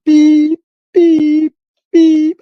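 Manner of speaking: high, loud electronic beep, three times; sharp, high-pitched beep imitation; imitated machine beeps. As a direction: squeak out a hospital monitor's beeps. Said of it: "I could hear the shit in the background".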